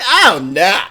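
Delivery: exaggerated catchphrase wail; overreacting friend. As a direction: imitate dramatic horror at a tiny mishap. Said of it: "he was like".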